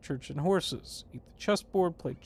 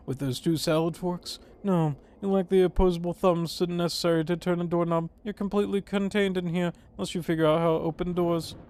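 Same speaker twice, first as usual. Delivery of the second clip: normal talking voice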